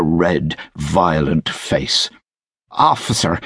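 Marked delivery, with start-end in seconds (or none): 2.7-3.4 mild cry. A narrator's reading. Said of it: "he cried".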